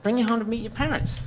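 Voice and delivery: squeaky nagging girlfriend imitation; mock girlfriend. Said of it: "So she's like".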